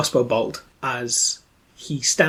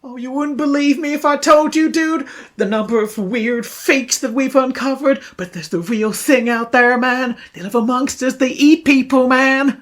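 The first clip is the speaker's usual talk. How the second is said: raised dude voice, building in alarm; agitated young dude; 8 semitones higher, 4 dB louder than usual. Acted. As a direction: spill a monster secret like a frantic slacker. Plays the young crossbow man.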